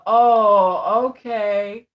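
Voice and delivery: imitating a let-down man; drawn-out, deflated acceptance; mock disappointment, trying to accept it; disappointed. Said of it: "being disappointed when I say".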